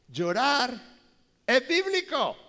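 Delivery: loud, high proclamation; preaching shout. Real, not acted. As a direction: proclaim it to the whole church. Preaching climax. (proclamación a gritos, más alto)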